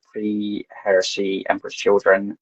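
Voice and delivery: ordinary delivery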